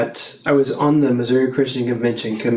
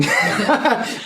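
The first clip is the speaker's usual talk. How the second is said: amused high laugh; 8 semitones higher than usual. Real, not acted. Laughs at her own remark.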